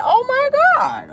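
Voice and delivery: a drawn-out, squealing high Oh my God; mock gasp. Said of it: "People are like".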